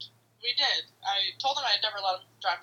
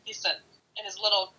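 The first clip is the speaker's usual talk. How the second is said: usual speaking voice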